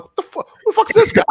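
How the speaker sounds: shocked outburst. Retold or imitated. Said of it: "I turn around, I'm like".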